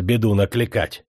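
plain reading voice

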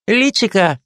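mocking mimicry. Lieutenant mocking the Kazakh's joke, mimicking «Личико». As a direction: mimic the word «Личико» to tease. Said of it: "передразнивает".

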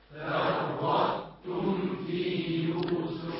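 distant group chant; faint repeat; class echoing from afar. Real, not acted. Students chanting back فرطتم في يوسف. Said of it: "بتعيدوا وراي".

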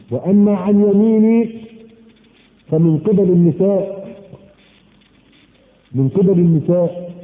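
slow emphatic sermon quote. Acted. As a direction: press each phrase slowly. Quoting Shaqiq slowly.

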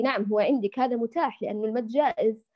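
ordinary talk, nothing marked

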